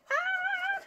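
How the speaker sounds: very high, held-back squeal; small pretend shriek of fright; mock scared scream. Acts out Eddie being scared.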